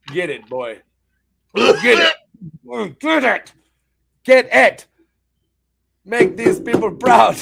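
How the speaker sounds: loud hype cheer; cheering shout. Real, not acted. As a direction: pump him up loudly. Cheering on the taster.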